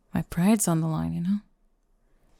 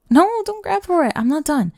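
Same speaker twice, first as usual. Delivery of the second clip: sharp protest; 11 semitones higher, 6 dB louder than usual. Acted. Stops listener grabbing notebook.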